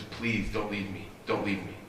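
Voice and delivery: hushed, low, slow mock pleading; tearful plea. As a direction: beg softly, retelling a heartbroken husband's tears. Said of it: "as he's crying and he says".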